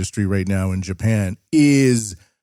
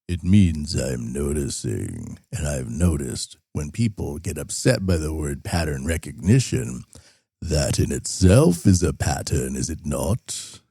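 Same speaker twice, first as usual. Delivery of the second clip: sly aside; 7 semitones lower, 4 dB quieter than usual. Acted.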